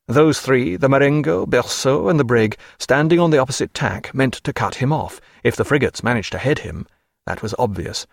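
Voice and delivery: usual speaking voice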